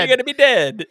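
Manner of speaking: high, loud mock yell; imitated yelling. Imitating the man waving his knife, comic yelling. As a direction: holler it in a hammed-up voice. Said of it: "And he keeps yelling".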